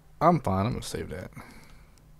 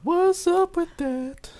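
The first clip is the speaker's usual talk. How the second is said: high mock squeal; 6 dB louder, slower than usual.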